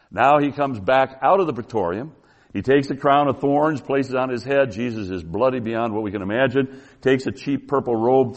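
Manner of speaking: ordinary talk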